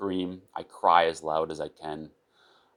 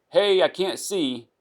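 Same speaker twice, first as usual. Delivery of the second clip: calling out; 12 semitones higher, 4 dB louder, slower than usual. Acted. Blind patient calling to nurse.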